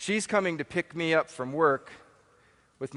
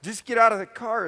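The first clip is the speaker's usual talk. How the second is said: higher, rushed and urgent; urgent panic; 7 semitones higher, 4 dB louder than usual. Retold or imitated. Husband urging stranded wife out of the car, urgent. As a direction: push her to get out fast. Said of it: "I'm thinking".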